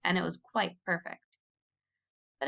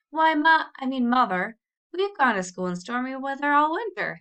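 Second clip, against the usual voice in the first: higher, louder pleading protest; eager protest; 5 semitones higher, 4 dB louder than usual. A narrator's reading. Tom arguing with Mother to go, loud eager protest. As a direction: press the point to win mother over.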